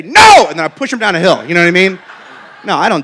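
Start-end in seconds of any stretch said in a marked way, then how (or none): 0.0-0.5 a loud, high, joking "No!"; mock refusal. Dad jokingly refusing his begging son, loud mock shout. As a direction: bark it out as a hammed-up mean dad. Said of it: "And so I say".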